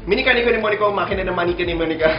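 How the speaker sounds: rapid-fire tongue twister; very fast talking. Real, not acted. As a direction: rattle it off fast.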